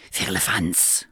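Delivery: unvoiced hissed scoff; hissing cat. Wandering cat hisses at the bear, whispered scorn. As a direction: spit the word out like a cat. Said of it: "fauchte die Katze".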